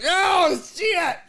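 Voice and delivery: high boozy exclamation; drunk guy voice. Retold or imitated. Drunk guest swears as the water dumps out, high voiced. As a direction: blurt it like a clumsy drunk.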